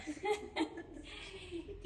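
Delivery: soft, real chuckle; quiet laugh at your own story. Real, not acted. Speaker telling how she called the pastor, soft laugh.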